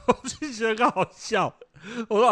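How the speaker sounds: high, slow and laughing through the words; amused at his daughter's excuse. Real, not acted. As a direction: let the giggle break into the line. Laughs while telling.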